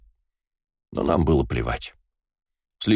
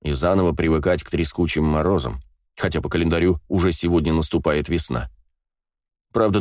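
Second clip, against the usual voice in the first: ordinary delivery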